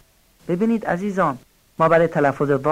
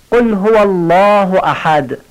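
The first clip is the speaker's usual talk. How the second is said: slow, loud chanted verse; Quranic recitation; 4 semitones higher, 7 dB louder, slower than usual. Shown as a demonstration. Recites surah. (تلاوت آهسته و بلند)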